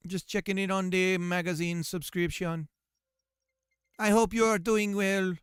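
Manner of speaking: hammed-up Jamaican scammer patter; terrible Jamaican accent. Scammer asking for a card number, mock Jamaican accent. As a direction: sell the prize in a badly faked Jamaican accent. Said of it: "my terrible Jamaican accent".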